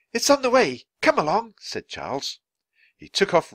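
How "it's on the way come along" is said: high, loud, cheerful urging; eager. A narrator's reading. Charles taking children to the cab, eager. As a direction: sweep them along with you.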